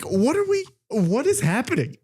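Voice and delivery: high-pitched baffled outburst; mock exasperation. Retelling his reaction to Siri, exasperated disbelief. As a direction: throw up a high, incredulous protest.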